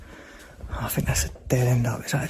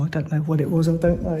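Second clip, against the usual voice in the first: ordinary talk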